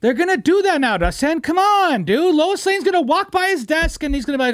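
ranting to Josh about the movie, high and loud; worked up rant. Real, not acted. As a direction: rant like you can't believe it. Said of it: "I guarantee you that's happening now".

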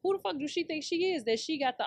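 ordinary delivery

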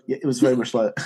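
normal talking voice

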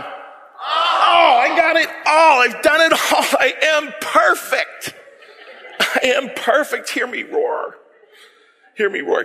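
strut like you're perfect; hammed-up bragging. Acting the boastful perfect ruler.

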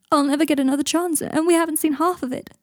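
high, loud strained plea; taut with pain. Acted. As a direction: push the words out through pain. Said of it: "his voice taut with pain".

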